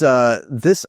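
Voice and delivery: ordinary talk, nothing marked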